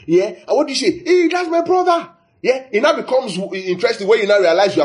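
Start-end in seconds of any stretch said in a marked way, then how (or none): 1.1-2.1 high delighted hail of recognition; excited greeting. Retold or imitated. Traveller spotting a countryman abroad, delighted high call.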